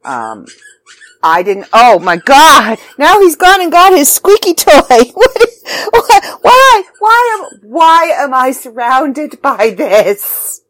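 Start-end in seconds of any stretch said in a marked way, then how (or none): 1.7-10.6 loud, high-pitched exasperated wail; why-me wail, loud and high; exasperated outburst. Real, not acted. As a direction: cry out in real exasperation. Exasperated outburst at dog's squeaky toy.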